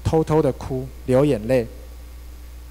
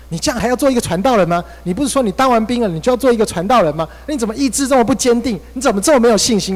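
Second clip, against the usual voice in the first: mother scolding; 7 semitones higher than usual. Retold or imitated. Mother scolding her son at dinner, high and sharp. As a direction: scold him like a disappointed mother. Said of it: "妈就骂我说".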